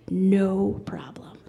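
slow hushed "No problem." with a little laugh; whisper. Retold or imitated. Cashier agrees to help secretly.

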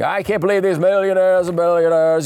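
hammed-up indignant politician impression; Bernie Sanders. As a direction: rail at the rich in mock outrage.

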